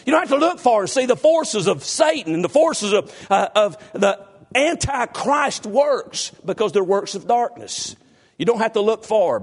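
high, drawn-out pulpit emphasis; fervent preaching. Acted. Preaching at full force.

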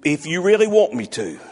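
low, soft, hesitant acceptance; feigned reluctance. Retold or imitated. Moses' mother asked to raise her own baby, feigned reluctance. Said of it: "She's thinking".

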